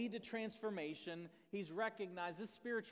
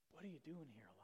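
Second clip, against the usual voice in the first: unvoiced, soft whisper; God whispering to Elijah; 2 semitones lower, 10 dB quieter, much faster than usual. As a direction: breathe it like a still small voice. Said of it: "the sound of a low whisper".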